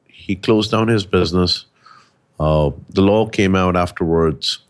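ordinary delivery

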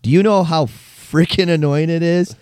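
mock angry reply. Annoyed stranger replying to the group, mock exasperated rant. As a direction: vent at the spammer, hammed up. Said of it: "was like".

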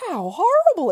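put-on high-pitched girlish outcry; horrified exclamation. A narrator's reading. Lucy appalled by the story, horrified exclamation. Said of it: "exclaimed Lucy".

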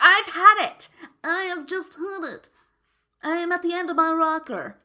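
put-on loud, high, fed-up old lady; exasperated old person. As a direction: throw up your hands, I've had it. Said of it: "I sound so old when I said that".

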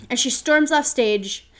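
usual speaking voice

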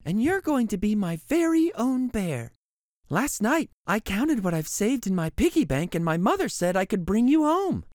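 put-on very high bright child's voice; little girl. Girl begging her mother for the bear, high child voice. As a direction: squeal it like an excited little girl.

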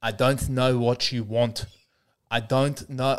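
put-on slow Liam Neeson speech in a South African accent. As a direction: rehearse it slowly with an attempted South African accent. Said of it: "work out how to say".

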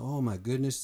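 normal talking voice

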